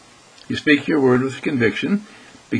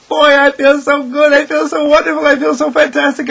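much higher and louder, bursting with delight; exuberant joy; 14 semitones higher, 7 dB louder than usual. Pretend. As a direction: gush like you're on top of the world. Voicing someone on top of world.

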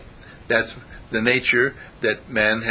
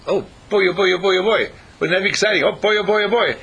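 put-on higher and louder, gushing oh boy; mock excitement; 7 semitones higher, 5 dB louder, faster than usual. Teacher mocking a student's glee, excited. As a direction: ham up the childish glee.

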